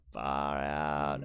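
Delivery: low, deflated drop; dismayed. Retold or imitated. Organiser recalls nobody signing up at the start, dismayed. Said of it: "no one signed up at the start".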